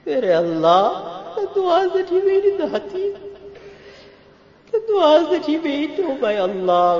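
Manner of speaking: very high, loud tearful wail; weeping prayer. Real, not acted. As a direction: cry out to God, overcome.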